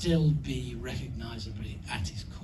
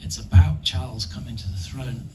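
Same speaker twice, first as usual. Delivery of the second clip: fast retold quote; 6 dB louder, faster than usual. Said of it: "it was".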